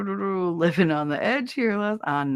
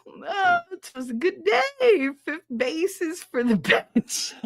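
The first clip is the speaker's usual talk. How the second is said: amused read; 10 semitones higher, slower than usual. Pretend. Reading chat message aloud.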